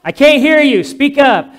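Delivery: loud, high, impatient yelling; mock shout of a deaf old friend; hard-of-hearing shout. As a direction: bellow it like an old lady who won't wear her hearing aid. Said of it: "and Gloria would say".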